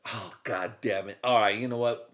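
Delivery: ordinary delivery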